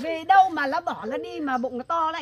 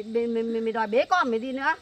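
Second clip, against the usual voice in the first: ordinary delivery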